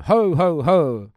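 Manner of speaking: loud jolly Santa laugh; booming ho ho ho. Acted. Ghost of Christmas present announcing himself with a laugh. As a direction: belly laugh like Father Christmas.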